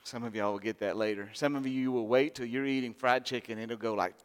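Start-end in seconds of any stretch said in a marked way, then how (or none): none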